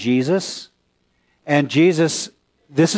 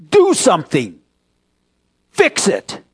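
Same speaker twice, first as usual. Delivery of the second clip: demanding; 4 semitones higher than usual. Shown as a demonstration. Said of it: "I don't think they're saying that".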